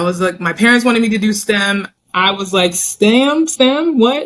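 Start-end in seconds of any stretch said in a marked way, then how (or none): 2.7-4.3 high, incredulous echo; baffled. Retold or imitated. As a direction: act out puzzled disbelief with the pitch jumping up. Retold baffled reaction to parents' wish.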